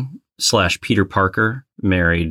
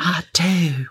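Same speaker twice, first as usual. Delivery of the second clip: echoing Whitley; 9 semitones higher than usual. Retold or imitated. Echoes the Whitley line.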